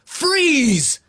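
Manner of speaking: high, sharp cry of Freeze; child's command shouted. Acted. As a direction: bark it like a little hero. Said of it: "he shouted".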